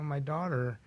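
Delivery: ordinary delivery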